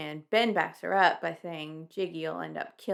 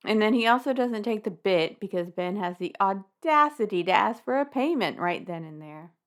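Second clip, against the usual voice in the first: usual speaking voice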